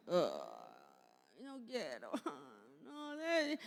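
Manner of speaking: put-on child whining about making the bed, dropping low then up; a kid whimpering, refusing the chore; whiny child. Mother imitates child whining over making the bed, mild whimper. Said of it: "y empiezan a llorar".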